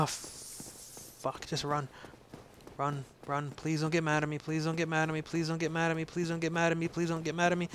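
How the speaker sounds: rapid repeated begging, running on without pause; nervous pleading. Real, not acted. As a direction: babble it like a plea to a dragon. Pleads with dragon while running past.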